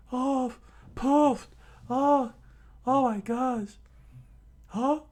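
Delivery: very high, squeaky, panting cartoon voice; winded Usopp. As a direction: gasp it out after the collapse. Usopp crawling out, shaken.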